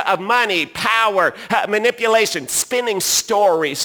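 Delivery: loud, high preaching voice; full-force preaching. Acted. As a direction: preach it out to the whole room. Preaching the Eden story.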